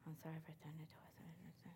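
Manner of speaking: put-on boy whispering sorry to the teacher; whispered apology. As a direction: breathe it out timidly.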